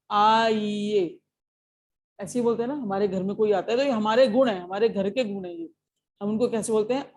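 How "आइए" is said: drawn-out welcoming आइए to a guest; welcoming aaiye. Shown as a demonstration. Teacher greeting a guest at the door, slow and welcoming. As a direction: say आइए slowly, inviting a guest in. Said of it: "क्या बोलते हैं उनको?".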